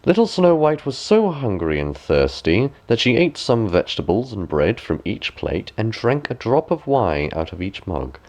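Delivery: ordinary delivery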